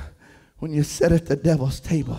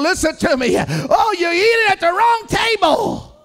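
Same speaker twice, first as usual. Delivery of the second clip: preacher's rising cry; 7 semitones higher than usual. Acted. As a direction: build the sermon to a peak. Preaching at full force.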